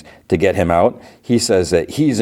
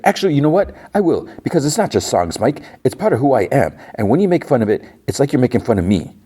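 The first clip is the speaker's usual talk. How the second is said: higher, wounded and indignant; upset outburst; 7 semitones higher, faster than usual. Retold or imitated. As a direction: blurt it out, hurt and defensive. Gary hurt in quarrel with Mike.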